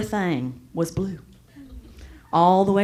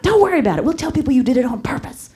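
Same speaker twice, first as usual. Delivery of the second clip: put-on higher, brighter reassuring girlfriend; friends reassuring; 10 semitones higher, 5 dB louder, faster than usual. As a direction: cheer up your friend, pitched high. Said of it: "They're like".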